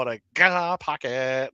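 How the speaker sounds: lightly mimicked hot pocket jingle; imitated comedian's jingle. Retelling a Gaffigan hot pocket bit, mild sing-song imitation. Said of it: "trying to do that thing".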